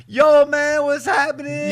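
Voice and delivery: very high-pitched slangy greeting; hammed-up, high "brothers" greeting; imitating the brothers. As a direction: put on an exaggerated high street greeting. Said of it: "the brothers come over, it's like".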